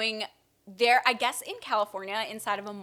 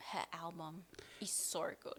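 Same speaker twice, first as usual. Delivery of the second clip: whispering; 4 semitones lower, 19 dB quieter than usual. Real, not acted. Gushing about the album.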